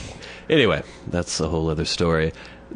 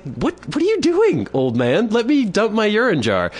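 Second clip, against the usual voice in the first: indignant protest; 14 semitones higher than usual. Retold or imitated. To the old man grabbing the jar, high and indignant. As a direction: act shocked and indignant.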